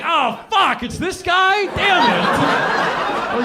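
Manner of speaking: high, loud dismayed outburst; mock dismay. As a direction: groan at who greets you in heaven.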